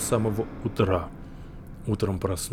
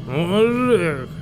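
put-on drunk voice; 9 semitones higher, 7 dB louder, slower than usual. Drunk barroom shout.

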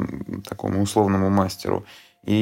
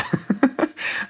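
breathy laugh. Real, not acted. Laughs at his own point.

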